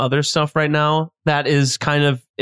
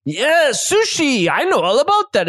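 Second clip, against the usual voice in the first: chef character voice; 8 semitones higher than usual. Acted. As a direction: stretch out the sushi slyly. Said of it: "he's saying".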